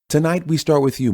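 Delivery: high, polished anchor tone; news anchor voice. Acted. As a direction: hand over to the guest like a TV host. Anchor turns to guest.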